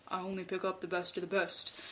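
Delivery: low, soft imitation of a big man; deep baritone voice. Driver answering an excited fan, mock deep baritone. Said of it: "deep baritone voice".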